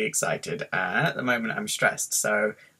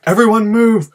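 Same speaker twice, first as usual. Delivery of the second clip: loud, high shout to clear the way; urgent shout; 11 semitones higher, 12 dB louder than usual. Retold or imitated. Stressed riser clearing a path to the bathroom, urgent shout. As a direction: yell it like you're barging through.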